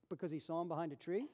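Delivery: ordinary talk, nothing marked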